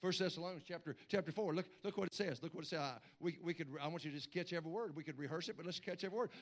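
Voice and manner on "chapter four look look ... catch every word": ordinary delivery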